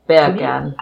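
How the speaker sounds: attempted Jamaican "beer can"; Jamaican accent. Shown as a demonstration. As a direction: say it Jamaican, sounding like "bacon". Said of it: "beer can in a Jamaican accent".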